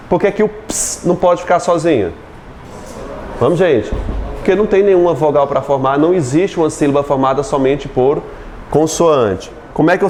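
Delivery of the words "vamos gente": ordinary delivery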